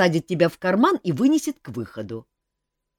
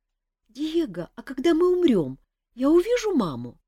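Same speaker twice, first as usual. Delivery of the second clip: put-on high, childlike chick voice; a little one asking about mama; small scared chick; 12 semitones higher than usual. A narrator's reading. Chick asks Diego about seeing mama, high childlike.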